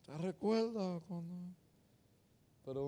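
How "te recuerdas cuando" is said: nostalgic to wife. Retold or imitated.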